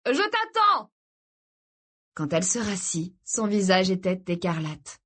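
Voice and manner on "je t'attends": high, carrying call from afar; pitched-up shout, held back in volume. A narrator's reading. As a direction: holler it up to someone at a distance. Mark calls up to Kirsty.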